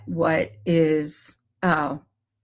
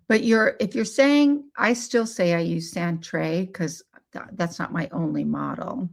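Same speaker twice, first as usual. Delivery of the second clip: ordinary talk, nothing marked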